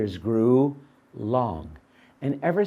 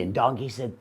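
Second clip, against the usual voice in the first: ordinary delivery, nothing marked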